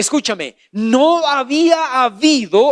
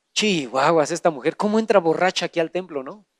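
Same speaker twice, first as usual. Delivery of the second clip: put-on lower, fast scoffing old priest; priest Eli scoffing; 5 semitones lower, 5 dB quieter, faster than usual. Preacher voicing priest Eli accusing Ana, lower and fast. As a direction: mutter it like a suspicious priest. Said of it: "La vio y dijo".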